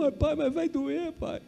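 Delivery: put-on high, slow, tearful child's voice; crying again. Frightened daughter begging her father, slow tearful whine. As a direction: plead like a scared little girl. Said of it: "chorando de novo".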